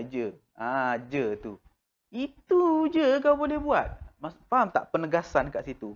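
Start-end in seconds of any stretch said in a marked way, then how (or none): 2.1-3.9 much higher, louder scornful jab. Retold or imitated. Teacher mocking someone with itu je. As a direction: sneer it as a put-down.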